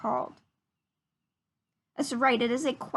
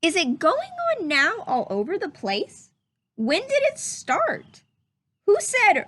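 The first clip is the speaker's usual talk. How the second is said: lively, curious rising questions; questioning read-aloud voice; 7 semitones higher, 4 dB louder than usual. Shown as a demonstration. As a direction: read each question like a wondering child. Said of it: "notice how I change my voice when I read a question".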